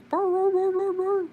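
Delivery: high, loud baying hound bark; hound bark imitation. Owner imitating her dog's hound bark, baying loudly. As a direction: howl it like a redbone hound. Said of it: "you hear the red bone hound".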